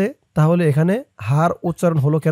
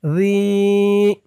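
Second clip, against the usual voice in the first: slow, chanted tajweed recitation; Quran recitation; 6 semitones higher, 4 dB louder, much slower than usual. Shown as a demonstration. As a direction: recite it melodically for students to follow. Teacher recites verse again.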